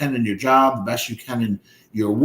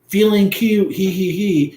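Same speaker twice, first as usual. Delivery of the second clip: mock giggle; 5 semitones higher than usual. Mocking girlfriend's bikini posts.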